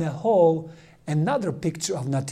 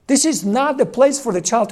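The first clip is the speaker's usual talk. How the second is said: raised, indignant objection; irritated protest; 8 semitones higher, 7 dB louder than usual. Retold or imitated. A priest complaining loudly about an ugly nativity painting. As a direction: push it out louder, offended by the painting. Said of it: "And it irritates him".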